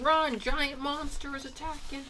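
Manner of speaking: high-pitched frantic warning; panicked townspeople. Retold or imitated. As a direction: cry it out way up high, scared crowd. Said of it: "they're saying".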